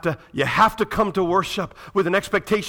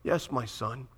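tender father; 3 semitones lower, 6 dB quieter, slower than usual. Acted. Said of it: "And he says".

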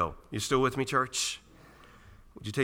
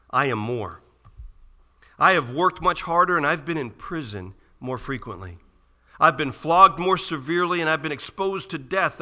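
loud, raised pulpit voice, hammering each item; impassioned scripture reading; 7 semitones higher, 9 dB louder than usual. Real, not acted. Preacher reading Paul's list of hardships to the church, impassioned declaiming. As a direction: declaim the list, climbing in pitch. Reads scripture at full force.

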